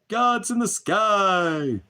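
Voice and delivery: high, slow, mocking voice of a naive believer; mocking sing-song. Preacher mocking believers who think God's in the sky, high sing-song. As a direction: stretch it out in a high, loud mock.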